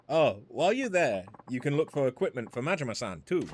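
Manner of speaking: put-on cheerful Chinese-accented shopkeeper. Woman greeting Majima, Chinese accent. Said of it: "Not the same without the accent".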